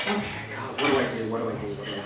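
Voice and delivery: low, soft worried repeating; whisper-mutter in quiet panic to God; hushed frantic prayer to himself; panicked mutter. Retold or imitated. Panicked after escaping home.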